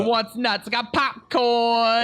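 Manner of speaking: high, slow ballpark vendor call; vendor accent. Pretend. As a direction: hawk nuts like a stadium seller. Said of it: "that's my vendor accent".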